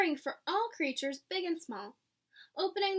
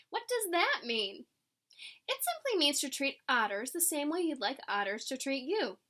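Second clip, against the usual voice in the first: plain reading voice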